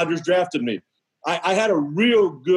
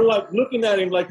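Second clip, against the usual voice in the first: usual speaking voice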